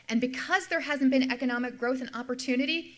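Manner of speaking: ordinary talk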